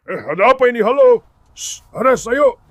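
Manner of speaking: loud calls to the animals. Real, not acted. Man calling to the noisy animals in the barn, loud.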